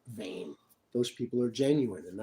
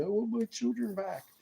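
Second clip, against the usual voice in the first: a lot higher, imitating his own tearful plea; pitched-up mock of himself pleading "children back"; retold crying plea; 10 semitones higher than usual. Quoting himself to the recording police, high tearful plea. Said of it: "as they're recording me crying".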